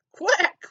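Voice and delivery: high, loud quack; duck quack. A narrator's reading. Duck greets Blue, loud quack. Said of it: "went a duck".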